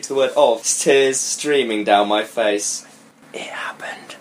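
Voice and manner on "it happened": very soft whisper; said quietly. A narrator's reading. Heroine confessing to her friend, quiet whisper. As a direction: barely breathe it out. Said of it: "I said quietly".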